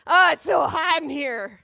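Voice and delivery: loud, hammed-up grumble; mock complaining. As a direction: complain dramatically about the heat. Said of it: "catch on to your complaint".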